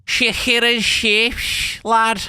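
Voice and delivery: very slow, high-pitched mock Scouse; Scouse accent. Shown as a demonstration. Streamer demonstrating Scouse to viewers, hammed-up Scouse accent. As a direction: stretch it out in exaggerated Scouse. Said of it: "That's Scouse".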